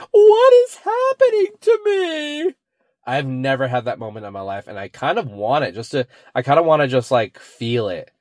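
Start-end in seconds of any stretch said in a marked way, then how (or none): 0.1-2.5 high, loud, slow panicked cry; horror-movie girl. Retold or imitated. Quoting the girl going crazy in the horror movie, high slow cry. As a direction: drag it out like a scream queen. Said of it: "And she's like".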